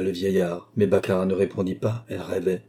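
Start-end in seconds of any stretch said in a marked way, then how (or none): none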